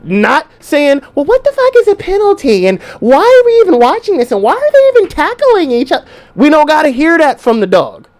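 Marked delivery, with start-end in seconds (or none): 1.2-6.0 high, fast mock girlfriend questions; hammed-up clueless girlfriend; whiny girlfriend. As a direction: whine like a bored date. Clueless nagging girlfriend at game.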